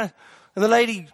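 normal talking voice

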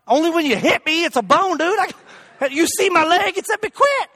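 high, agitated retort; pained comic protest; mock indignation. Acts out snapping at poking doctor.

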